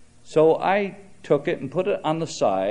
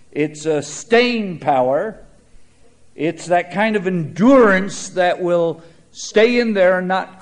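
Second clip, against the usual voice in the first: preaching; 3 semitones higher, 5 dB louder than usual. Acted. Pastor preaching on trials to students, full sermon voice. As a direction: press the point home from the pulpit.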